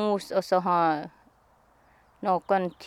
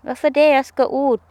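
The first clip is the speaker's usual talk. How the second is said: indignant retort; 5 semitones higher, 8 dB louder than usual. Retold or imitated. Arguing with Peter in story.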